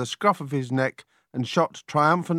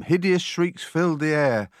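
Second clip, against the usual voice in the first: usual speaking voice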